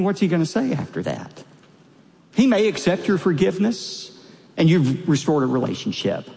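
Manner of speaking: ordinary talk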